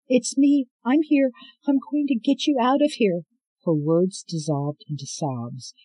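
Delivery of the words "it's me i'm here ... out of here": higher, loud urgent reassurance; anxious young woman. Acted. Scarlet finding her grandmother in the cell, anxious and urgent. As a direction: rush to calm her with your voice.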